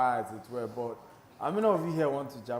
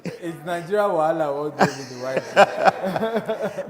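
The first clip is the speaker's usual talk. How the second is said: laughing; 9 dB louder, slower than usual. Real, not acted.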